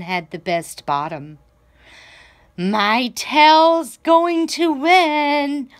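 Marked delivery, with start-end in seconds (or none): 2.5-5.7 slow, high, louder boast; bragging. A narrator's reading. Peacock boasting to the other animals, slow high brag. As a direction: stretch it out like a proud show-off. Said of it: "bragged Peacock".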